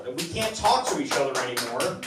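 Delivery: ordinary delivery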